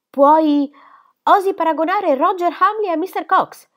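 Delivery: indignant. A narrator's reading. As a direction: challenge her with outrage. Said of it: "chiese Molly indignata".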